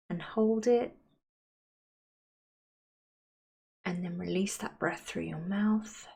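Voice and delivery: unhurried, soothing visualisation, softening at phrase ends; guided meditation. Acted. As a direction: guide them gently into calm, leaving space to breathe.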